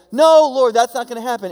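mock alarmed Peter; Peter protesting. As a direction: blurt the denial like an alarmed disciple. Peter protesting in retold scene.

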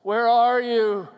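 loud, drawn-out cry of anguish; slow, loud, pained calling; anguished father's cry. Acted. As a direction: cry out like a father searching for his child. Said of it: "cry of an anguished father".

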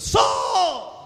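high, loud stern call of a name; calling out Saul. Retold or imitated. Prophet calling the king by name, loud. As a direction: summon him sharply. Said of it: "he goes".

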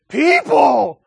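loud, high shriek of fright; panicked yell. Retold or imitated. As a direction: scream it as the terrified monster. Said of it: "He freaks out and he goes".

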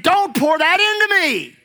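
high, emphatic mock protest; protesting body. Drinker's body objecting to liquor, acted protest. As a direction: cry out in refusal, acting it up. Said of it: "Your body's saying".

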